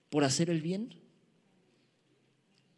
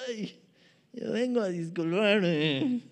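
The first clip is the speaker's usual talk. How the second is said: reluctant apology; slower than usual. Retold or imitated. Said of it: "Así de".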